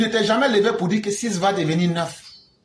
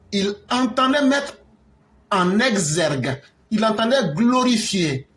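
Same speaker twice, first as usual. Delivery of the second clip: pompous slow; 3 semitones higher, slower than usual. Retold or imitated. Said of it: "exergue, glorifier, ça veut dire quoi?".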